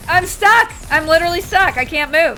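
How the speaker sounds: high, loud cry of frustration; loud dismay, easing down in pitch; exasperated yelling. Real, not acted. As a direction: yell helplessly at the game. Vehicle cannot move.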